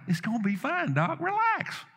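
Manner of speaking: lightly voiced breezy mom, rising high on relax; a hint of a carefree mother soothing the doc; breezy reassurance. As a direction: toss it off high and easy, brushing the doctor's worry aside. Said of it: "And she's like".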